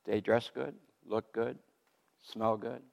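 ordinary talk, nothing marked

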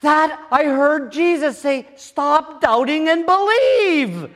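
high, loud, slow and emphatic; excited quote. Retold or imitated. Friend telling what Jesus said, slow excited declaration. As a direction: declare it with awe. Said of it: "He says".

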